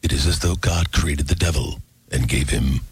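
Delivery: low, slow trailer narrator; deep, drawn-out trailer voice-over; movie trailer voice. Shown as a demonstration. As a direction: intone it like an ominous film trailer. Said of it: "You wanna hear a trailer voice".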